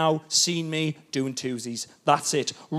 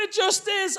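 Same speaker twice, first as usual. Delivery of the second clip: wife voice; 13 semitones higher, 4 dB quieter, slower than usual. Retold or imitated. Wife's voice.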